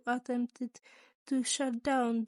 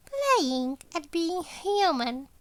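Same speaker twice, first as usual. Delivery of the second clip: slow, bitter spat-out words, higher; spitting out words; 5 semitones higher, 5 dB louder, slower than usual. A narrator's reading. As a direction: spit it out with barely hidden anger. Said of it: "practically spitting out his words".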